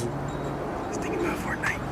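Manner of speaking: slow hushed whisper. Real, not acted. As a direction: whisper it like a secret aside. Teasing aside about absent friend.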